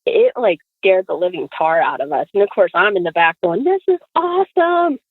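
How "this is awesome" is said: high, gleeful retold squeal; acted excitement, pitched way up; thrilled kid. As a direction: cheer like a kid loving the danger. Thrilled kid in back seat.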